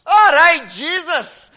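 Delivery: very high, loud Scotsman; hammed-up Scottish shout; Scottish accent. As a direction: bellow it high as an excited Scot. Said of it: "his Scotsman and says".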